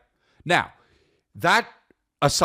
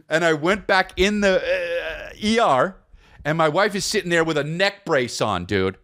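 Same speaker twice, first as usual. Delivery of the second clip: ordinary talk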